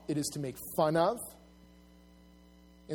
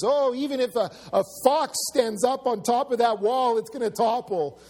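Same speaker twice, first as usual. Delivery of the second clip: higher, louder jeering quote; mock sneering ridicule, pitched up; 7 semitones higher, 5 dB louder than usual. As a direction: jeer it loudly, imitating scoffers. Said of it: "They're being laughed at".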